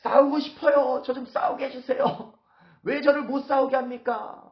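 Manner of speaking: very high-pitched, hammed-up pleading protest; brazen bluster. David begging Achish to let him fight, brazen bluster. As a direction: wail indignantly to be let fight. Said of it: "막 똥배짱을 부려가면서".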